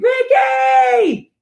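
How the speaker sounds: high, loud shout of the name; hammed-up cry over a shot son; mock anguished yell. Wife reenacting a mother's scream from a movie, high loud shout.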